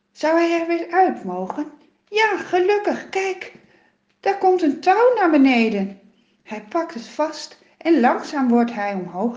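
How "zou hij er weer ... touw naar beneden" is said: higher, louder joyful relief; relieved excitement. A narrator's reading. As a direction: brighten with sudden hope. Relief as rescue arrives.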